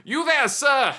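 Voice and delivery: loud, high hawker's holler; yelling. Retold or imitated. Fair vendor hails visitor walking away, loud put-on yell. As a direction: call out to a passer-by in character.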